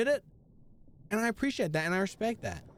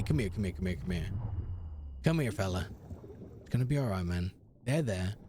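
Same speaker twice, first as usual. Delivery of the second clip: lower, softer soothing voice; mock comforting; 11 semitones lower, 4 dB quieter than usual. Streamer comforting himself after asking to be held, lower mock soothing. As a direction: hush a scared pet, tongue in cheek.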